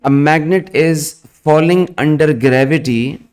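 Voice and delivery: slow, word by word dictation; dictating. Acted. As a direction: dictate slowly for students to write. Dictation repeated.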